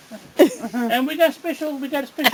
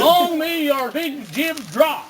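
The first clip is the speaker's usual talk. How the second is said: very slow, drawn-out toast; slow Newfoundland toast; slow demo; much slower than usual. Shown as a demonstration. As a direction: teach it word by word. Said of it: "No, no".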